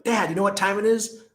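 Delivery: a hint of a little boy; little kid voice. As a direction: imitate your small son asking eagerly. Five-year-old son voiced in retelling.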